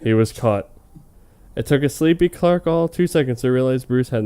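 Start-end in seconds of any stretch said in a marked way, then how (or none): none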